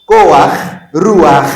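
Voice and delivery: loud, crisp "Koach", "Ruach", each ending in guttural ch; furtive patah demo. Shown as a demonstration. Teacher drills the class, loud "Koach", "Ruach" with final ch. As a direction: punch out "Koach", "Ruach", a before ch. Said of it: "Vous prononcez d'abord les A".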